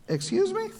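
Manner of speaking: high, squeaky mock offense; a falsetto, indignant excuse me. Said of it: "you're like".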